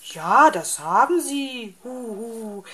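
indignant owl with a hoot; reproachful owl. A narrator's reading. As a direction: insist firmly, then drop to a falling hu-hu. Owl reproaches fox, then hoots.

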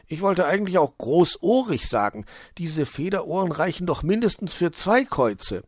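lightly voiced kobold retort; teasing kobold. Wuschel retorts in read story.

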